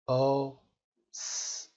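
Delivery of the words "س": "س" as a voiceless hiss, sss; isolated s sound. Shown as a demonstration. Teacher introduces the lesson's sounds, isolated s. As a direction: hold "س" as a soft pure s, no vowel. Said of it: "صداهای ای".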